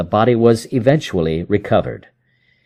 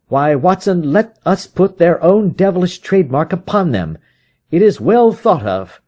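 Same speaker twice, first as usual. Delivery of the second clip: higher, vengeful detective voice; Holmes, grim satisfaction; 7 semitones higher than usual. Acted. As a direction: savour the plan.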